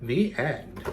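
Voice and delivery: gentle storytelling read; story narration. A narrator's reading. Reading a legend to children, gentle storytelling.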